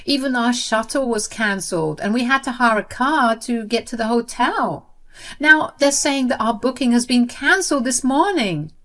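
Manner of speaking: high, loud, panicky pleading; acted sister-in-law close to tears; panicked near tears. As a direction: blurt it out in a near-tearful panic. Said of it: "called me in a panic and on the verge of tears".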